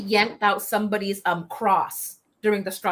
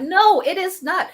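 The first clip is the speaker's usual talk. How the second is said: indignant denial; 10 semitones higher, 5 dB louder than usual. Real, not acted. Rejecting the Pentagon's suicide ruling.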